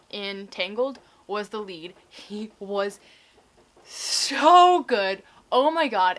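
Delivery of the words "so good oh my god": loud, swooning rave; gushing. Real, not acted. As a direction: burst out in real delight. Gushing about the lead actor.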